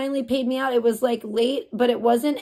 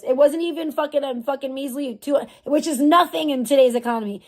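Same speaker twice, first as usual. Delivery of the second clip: ordinary delivery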